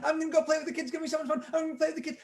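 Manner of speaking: put-on high, bouncy little girl voice; excited Clara. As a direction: play Clara bursting to go play.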